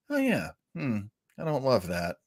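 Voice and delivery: ordinary delivery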